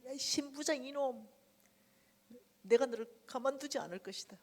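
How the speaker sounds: mock curse at the manager; retold cursing. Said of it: "욕이라는 욕을 다 퍼봤습니다".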